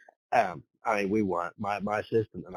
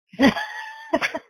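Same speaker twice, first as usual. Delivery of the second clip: airy high giggle burst; high breathy laugh; 5 dB louder than usual. Real, not acted. Laughs after punchline.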